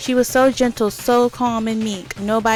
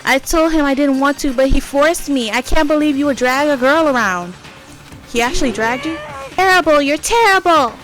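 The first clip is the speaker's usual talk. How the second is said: angry shout; 4 semitones higher, 6 dB louder than usual. Acted. Voices Toko complaining about being dragged.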